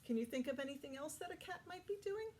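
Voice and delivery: ordinary talk, nothing marked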